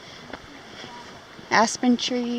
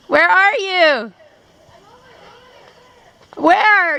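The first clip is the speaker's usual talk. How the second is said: calling out; 7 semitones higher, 9 dB louder, slower than usual. Real, not acted. Calling dog across canyon.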